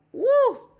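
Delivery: high, loud whoop of awe; loud swooning exclamation; delighted "woo". Retold or imitated. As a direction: let out an awed, high "woo". Awed at how she smelled.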